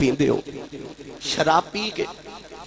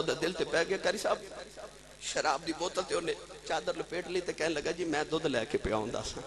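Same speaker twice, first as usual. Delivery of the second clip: much softer, suspenseful narration; hushed storytelling; 13 dB quieter than usual. Acted. Drunkard caught by approaching Umar, hushed storytelling. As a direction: tell it in a hush.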